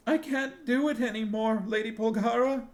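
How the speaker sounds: high, loud, distraught cry. A narrator's reading. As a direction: break down in despair. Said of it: "Sinedra cried".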